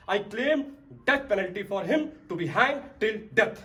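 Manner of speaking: forceful courtroom climax; solemn sentencing demand. Acted. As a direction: demand the sentence, weighting each phrase. Lawyer demands the death sentence.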